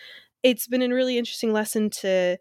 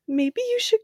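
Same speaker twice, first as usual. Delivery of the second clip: high, gentle motherly nudge; sweet mom; 13 semitones higher than usual. Retold or imitated. Mom gently urging a sobbing daughter, high and sweet. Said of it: "our mom is so sweet".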